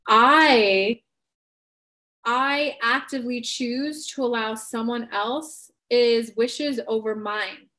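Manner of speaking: slow, deliberate self-realization; slow, thoughtful script-flip. Shown as a demonstration. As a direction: let it dawn on you slowly. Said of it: "you flip the script by saying".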